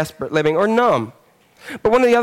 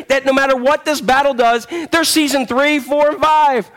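preaching full force; 8 semitones higher than usual. Acted. Preacher rallying the church about faith, fervent. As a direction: rouse the congregation with certainty.

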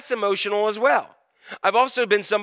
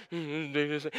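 gloomy grumble; 6 semitones lower, 12 dB quieter than usual. Acted. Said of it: "disgusting, rainy, snowy, whatever".

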